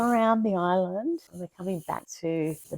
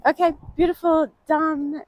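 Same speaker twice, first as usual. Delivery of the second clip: relieved and excited; 11 semitones higher, 8 dB louder than usual. Real, not acted. Just finished swim, out of breath.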